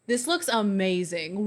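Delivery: ordinary talk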